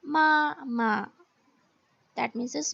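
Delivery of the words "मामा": मामा slowly, falling to a light second syllable; मामा with toneless ending; neutral tone demonstration. Shown as a demonstration. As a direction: stress the first मा, let the second drop. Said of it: "second example है".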